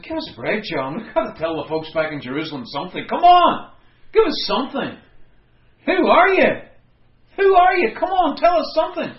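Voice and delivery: high, loud exasperated pleading; pleading demand. Retold or imitated. Priests badgering John for an answer, loud and pleading. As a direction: press him hard, almost shouting. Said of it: "they said".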